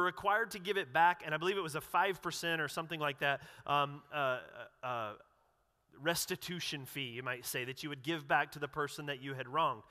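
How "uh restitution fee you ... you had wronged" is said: usual speaking voice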